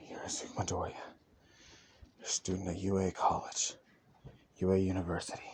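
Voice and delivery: quiet, breathy muttering to oneself; steady yourself under your breath with heavy sighs; low-volume, shaken pep talk between breaths; hushed self-reassurance. Acted. Character steadying himself after flashbacks.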